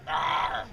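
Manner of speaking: low demonstrated growl; deep animal arr, acted as a cue. Shown as a demonstration. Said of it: "Harry will growl".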